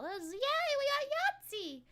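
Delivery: high, slow sarcastic cheer; mock cheer. Streamer cheering apocalypse bingo win, high mock cheer. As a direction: cheer like a game show winner, ironically.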